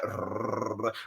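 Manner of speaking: low soft growl through the teeth; quiet imitated dog snarl; mock dog growl. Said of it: "And he like".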